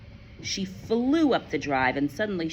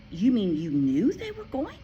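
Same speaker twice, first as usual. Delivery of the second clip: shocked cry; 6 semitones higher, 4 dB louder than usual. A narrator's reading. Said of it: "Matilda cried".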